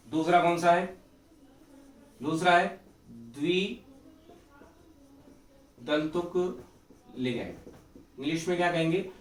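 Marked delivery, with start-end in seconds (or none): 3.1-7.5 drawn out very slowly with long gaps; word by word, slowly; slow dictation. Real, not acted. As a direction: stretch the term out as if writing it. Writing out the term while saying it.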